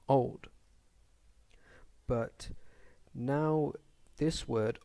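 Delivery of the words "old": put-on old said loud and firm as one stressed syllable. Shown as a demonstration. Teacher showing students the single stressed syllable, firm stress. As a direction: lean into "old" with full weight on its single syllable. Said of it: "so we stress that one syllable".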